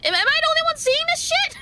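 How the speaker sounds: high, fast disbelief; rushing words in alarm; freaked out. Real, not acted. Reacting to creepy sight.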